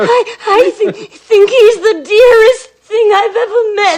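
gushing through tears, rising at the end; sobbing with tenderness for fragile Felix; tearful. Acted. Sister weeping over Felix.

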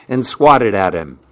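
ordinary delivery, nothing marked

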